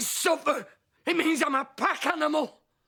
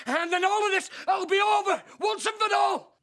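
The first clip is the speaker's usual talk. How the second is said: defiant shout; 7 semitones higher than usual. Acted. Man defying his friend about the neighbours, shouting.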